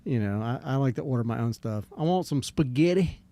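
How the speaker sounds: ordinary delivery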